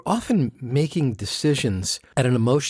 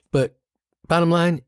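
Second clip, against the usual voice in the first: normal talking voice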